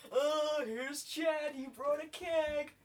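high, excited American party voice; American teenager. Acted. Greeting Chad with the keg, high American teen whoop. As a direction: cheer loudly, dragging out the American vowels. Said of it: "I should sound like an American teenager".

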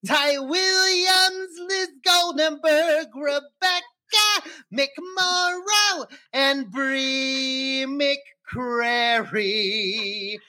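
slow, high, grand announcer read. Acted. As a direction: call out each name like a show host. Announcing patron names.

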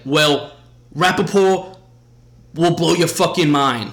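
imitating the host bragging about himself; hype-man boast. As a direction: announce it like a showman pumping the crowd. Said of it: "And he's like".

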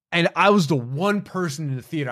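ordinary delivery

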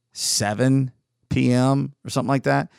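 usual speaking voice

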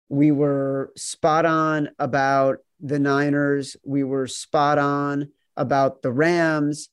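slow, deliberate boast; slow emphatic boast. Acted. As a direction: savor each word slowly.

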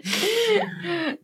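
high laugh. Real, not acted. Laughing after joke.

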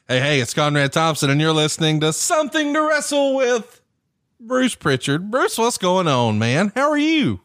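rising, stretched-out podcast announcer; show host intro. Real, not acted. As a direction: launch the show with high, drawn-out flourishes. Opens the show.

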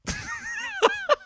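helpless high laugh; high squealing laugh. Real, not acted. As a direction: burst of high-pitched laughter. Laughing at the kid's words.

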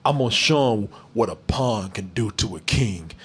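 low, soft mock-serious delivery; lightly voiced brooding hero; mock tough line. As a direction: parody the hero's dramatic line. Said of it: "he ruins the whole thing by saying".

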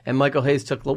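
normal talking voice

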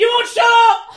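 high, loud yell; yelling at a chatty cohost; shout. Real, not acted. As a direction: shout it out in exasperation. Shouted outburst.

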